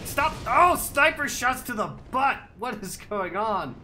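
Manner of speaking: loud exasperated outburst; raging gamer. Real, not acted. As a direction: yell at the unfairness. Shot by snipers mid-game.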